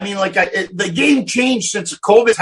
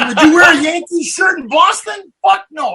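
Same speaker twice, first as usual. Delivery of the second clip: indignant retort; 7 semitones higher, 5 dB louder than usual. Retold or imitated. Acting out heated argument over a shirt.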